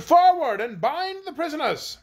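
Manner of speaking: high-pitched military order, not full volume; shouted command. A narrator's reading. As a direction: call out the order like a general. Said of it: "the generals now shouted".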